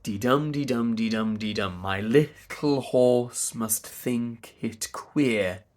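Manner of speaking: iambic beat, "dum" stressed, "dee" weak; measured di-DUM rhythm on "My little horse must think it queer"; metrical stress. Shown as a demonstration. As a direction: chant "dee-dum" and the verse in rising pairs. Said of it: "in the unstressed position".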